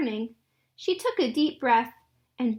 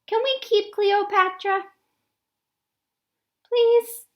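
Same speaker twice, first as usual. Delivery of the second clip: put-on pleading little girl; 8 semitones higher than usual. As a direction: beg Mama like a hopeful kid. Girl begs to keep kitten.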